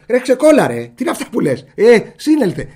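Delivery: loud rough jab at a friend; mock rough retort. Teasing a friend, a loud rough mock retort. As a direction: shout it like teasing a buddy. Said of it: "Δεν λέτε σε ένα".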